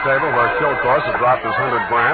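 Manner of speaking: clipped private-eye voiceover; noir narration. A narrator's reading. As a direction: tell it like a hard-boiled detective. Hard-boiled narration over music.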